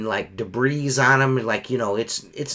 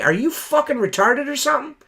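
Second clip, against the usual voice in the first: much higher, incredulous angry challenge; retold cussing-out; 11 semitones higher than usual. Window cleaner challenging rude customer, retold cussing-out. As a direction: retell an angry confrontation with the pitch jumping up. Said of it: "I was cussing at this motherfucker".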